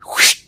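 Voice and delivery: voiceless, loud whoosh; imitated ripping whoosh. Storyteller shows his pants splitting, imitated whoosh. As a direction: make a rush of air for tearing cloth.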